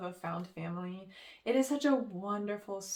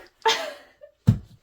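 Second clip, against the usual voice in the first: a bright, high real laugh breaking out; burst of laughter; 12 dB louder than usual. Real, not acted. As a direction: laugh out loud before going on. Laughs after introducing next book.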